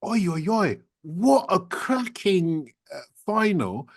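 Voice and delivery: high, slow, delighted "oi, oi, oi, what a cracking"; excited translation. Retold or imitated. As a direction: stretch it out with high glee. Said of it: "And that's".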